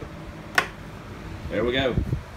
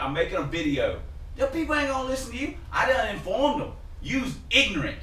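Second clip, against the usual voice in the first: high, heated threats in a put-on voice; acted shop quarrel; 11 semitones higher than usual. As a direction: square up to Big Tony. Acted quarrel with Big Tony.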